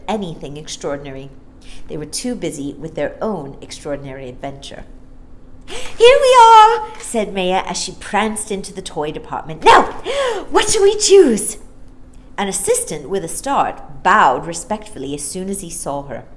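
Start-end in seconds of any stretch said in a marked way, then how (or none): 5.7-11.6 put-on very high, loud gleeful little girl; excited child. Maya enters the toy shop, high gleeful little girl. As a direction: prance in with glee.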